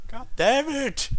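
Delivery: high, loud, slow God damn it; frustrated gamer outburst; exasperated yell. Real, not acted. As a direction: yell at the game in mock frustration. Character dies in game.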